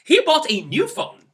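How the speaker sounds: high, loud, thrilled; thrilled for your friend; excited for a friend's new phone. Shown as a demonstration. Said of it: "you are excited for him or her".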